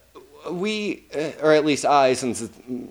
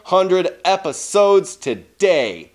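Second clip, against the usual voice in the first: big slow showman welcome; announcer voice; 4 semitones higher, slower than usual. Acted. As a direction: hype up the milestone, drawn out. Celebrating episode one hundred.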